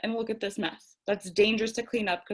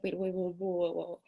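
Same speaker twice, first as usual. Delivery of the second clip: much softer, hushed self-soothing; calming herself down; 3 semitones lower, 9 dB quieter than usual. Retold or imitated. Stopping her own tantrum over spilt pickles, a soft calming hush. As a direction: ease yourself down gently. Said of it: "And I was like".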